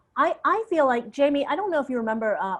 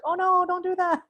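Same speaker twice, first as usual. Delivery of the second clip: higher, urgent mock plea; pleading advice; 8 semitones higher than usual. Speaker urging young designers, a higher mock plea. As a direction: beg them to just try it. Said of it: "And it's just like".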